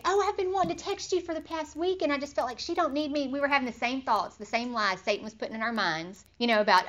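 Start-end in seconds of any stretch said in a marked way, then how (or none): 0.0-3.3 higher, lightly voiced friend; friend's text. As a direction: read the friend's message in her voice. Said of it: "And she texted".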